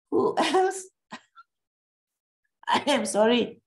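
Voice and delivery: laughing. Real, not acted. Chuckling while apologising.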